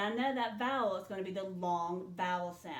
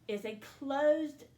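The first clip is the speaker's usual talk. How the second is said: closed stressed, slow and louder; emphatic answer; 5 semitones higher, 5 dB louder, slower than usual. Shown as a demonstration. Teacher stressing closed when answering the class. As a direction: lean into closed slowly. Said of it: "Is it open or closed?".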